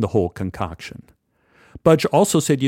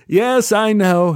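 lightly English, higher and louder; a polite English gent's greeting; English accent; 9 semitones higher, 5 dB louder, slower than usual. Retold or imitated. English stranger greets narrator at the bar, lightly English. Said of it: "he said".

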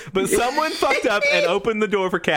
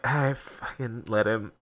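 low, soft, slow resignation; worn-out, dropped voice; tired; 11 semitones lower, 7 dB quieter, slower than usual. Acted. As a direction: give up wearily with a faint laugh. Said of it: "Yazan's so tired".

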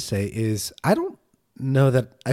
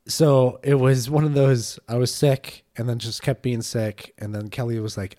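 normal talking voice

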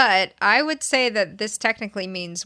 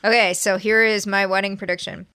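normal talking voice

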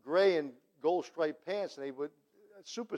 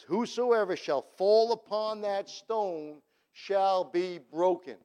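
slow preaching proclamation; 5 dB louder, slower than usual. Acted.

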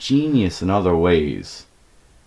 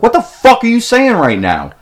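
loud, high-pitched exasperation; incredulous outburst; 7 dB louder than usual. Retold or imitated. Storyteller confronts friend over his accent, loud incredulous outburst. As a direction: burst out in appalled disbelief.

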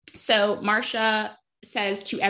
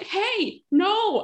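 high, nervous eager voice, pitched way up; awkward cousin Greg; 6 semitones higher, slower than usual. Retold or imitated. Podcaster imitating Greg flustering to the bodyguard in a high nervous voice. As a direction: stammer it out like a flustered nobody. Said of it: "And so Greg is like".

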